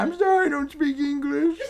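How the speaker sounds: high, loud, laughing plea; mock baffled foreigner. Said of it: "I'm gonna be like".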